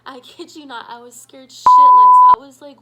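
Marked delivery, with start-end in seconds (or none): 0.9-2.4 high, loud scream of fright; scared scream. Real, not acted. Telling friends about the scare, high frightened scream. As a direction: scream it like the fear is still fresh. Repeating how terrified she was.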